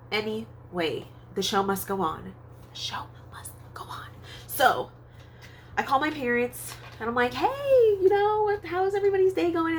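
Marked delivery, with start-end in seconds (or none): 2.7-4.1 hushed stage whisper; all breath, no voice. Pretend. Whispered echo of same phrase. 7.3-9.8 high, loud, eager phone hello; re-enacted perky call to parents; acted cheery greeting. Acts out hopeful call to parents.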